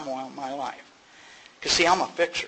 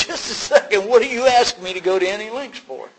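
higher, louder, taken-aback protest; indignant retort; 6 semitones higher, 9 dB louder than usual. Retold or imitated. Newcomer protesting to his sponsor, higher, louder, indignant. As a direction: push back like you've been insulted.

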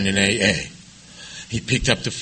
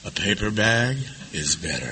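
softer, evenly calm imitation of the friend; 4 semitones lower, 8 dB quieter than usual. Friend calmly corrects the panicking [name], softly. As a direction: say it like nothing could rattle you. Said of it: "he said very calmly to me".

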